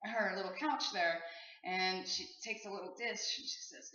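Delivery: usual speaking voice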